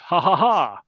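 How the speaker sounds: gloating fake French laugh; French accent. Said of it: "another French person in the beret".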